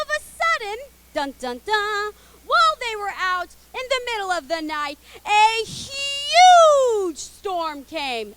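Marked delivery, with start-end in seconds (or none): 5.2-8.3 huge stretched out very slow and much louder; dramatic huge storm. Shown as a demonstration. Telling the kids about the storm, dramatic slow huge. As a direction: draw out huge storm slowly and loudly. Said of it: "Everyone go, "Huge".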